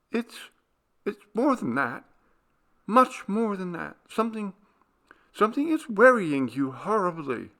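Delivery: a frightened wife sensing his secret; Clarice, worried. A narrator's reading. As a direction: press him gently but urgently. Clarice voiced higher.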